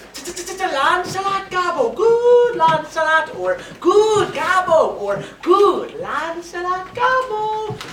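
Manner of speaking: high, drawn-out coaxing that builds to a shout; urging fiend. Acted. The fiend tempting Lancelot to run from his master, urging. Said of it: "the fiend, is at mine elbow, saying to me".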